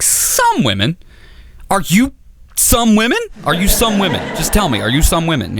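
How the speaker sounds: high, incredulous questioning; jealous boyfriend grilling his girlfriend, high and incredulous; indignant disbelief. Retold or imitated. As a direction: press her with mock outrage.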